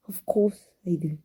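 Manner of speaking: usual speaking voice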